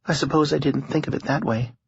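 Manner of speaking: a hint of a tired young woman. A narrator's reading. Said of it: "she confessed".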